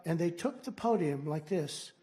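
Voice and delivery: ordinary delivery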